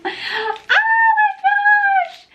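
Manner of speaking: opening the gift box, squealing oh my gosh; surprised shout. Real, not acted. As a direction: shriek it out stretched long in surprise.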